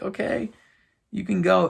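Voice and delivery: retold teary farewell; mock tearful goodbye. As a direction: let the child go in a mock-weepy voice.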